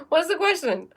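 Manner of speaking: asked through laughter, high; laughing. Real, not acted. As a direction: laugh while you ask it. Laughs while asking viewer.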